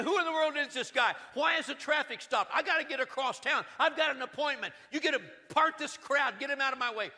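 a busy man shoving through the crowd, demanding; impatient complaint. Retold or imitated. Impatient townsman stuck behind the parade, loudly irritated.

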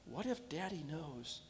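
put-on a child's worried inner voice; fearful doubt. Boy's fearful private doubts.

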